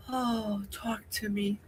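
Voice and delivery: soft, swooning plea; dreamy swoon. Real, not acted. Gushing to the chat about loved accents, soft swoon. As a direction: say it softly, mesmerized by the voice.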